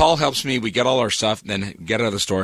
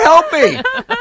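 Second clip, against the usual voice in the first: mock desperate cry; 11 dB louder than usual. Retells desperate plea on phone.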